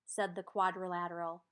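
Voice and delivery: ordinary delivery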